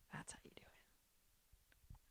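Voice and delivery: barely audible whisper. Real, not acted. Wrapping up the perfume marketing scheme, in a slow whisper. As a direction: whisper it slowly, like a secret tip.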